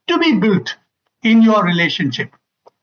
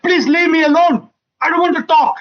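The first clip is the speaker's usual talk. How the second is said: higher, sharp plea to be left alone; mock angry wife; 7 semitones higher than usual. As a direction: play the hurt, cross wife. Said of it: "she in her anger said".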